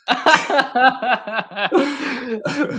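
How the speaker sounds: long high laugh. Real, not acted. Keeps laughing at the joke.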